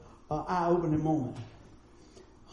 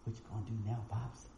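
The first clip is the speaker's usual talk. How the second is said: soft taunt; 9 semitones lower, 12 dB quieter than usual. Retold or imitated. Chin on dad's ear, taunting.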